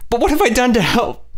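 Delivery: weeping plea; sobbing. A narrator's reading. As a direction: sob the question out. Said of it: "she sobbed".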